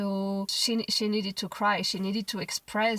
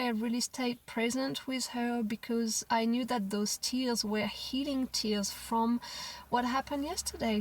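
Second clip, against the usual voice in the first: normal talking voice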